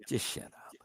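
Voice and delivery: whispered, voiceless shut-down. Real, not acted. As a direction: breathe it out with no voice, contemptuous. Hissed insult after shouting.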